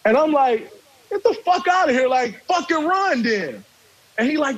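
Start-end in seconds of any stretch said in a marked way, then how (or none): 1.1-3.6 high, heated clapback that slows at the end; re-enacted heated clapback in a story; retold angry retort. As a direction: snap back at him, much higher, then drag it out. Heated retort during the argument.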